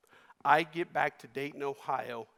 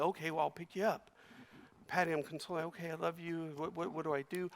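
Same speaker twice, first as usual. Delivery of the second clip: put-on softer, fast soothing words to a baby; soft consoling; 2 semitones higher, 6 dB quieter, faster than usual. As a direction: hush and comfort a crying infant. Nine-year-old soothing crying baby sister.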